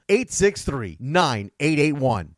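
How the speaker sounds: normal talking voice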